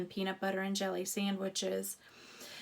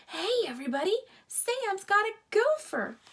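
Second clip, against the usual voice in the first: high, loud excited shout; boy yelling; 10 semitones higher, 8 dB louder than usual. A narrator's reading. Boy calls the camp to the dig, excited yell. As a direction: call out to the whole camp. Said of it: "a boy yelled".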